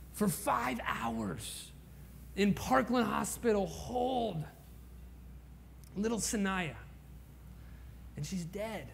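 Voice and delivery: very soft, slow, breaking with grief; hushed grief. Real, not acted. Pastor recalling holding the stillborn baby, hushed grief. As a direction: let the words nearly vanish in sorrow.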